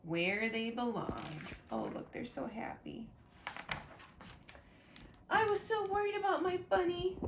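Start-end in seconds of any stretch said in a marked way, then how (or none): 5.3-7.2 put-on Sonia's girlish line, higher and quicker; higher child voice. Sonia telling Trixie she was worried, in a higher child voice. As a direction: voice a worried little girl, pitched up. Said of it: "said Sonia".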